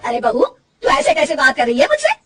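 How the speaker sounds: loud, indignant rebuke; scolding the bahu for her tone; offended mother-in-law. Acted. (नाराज़ सास की डाँट)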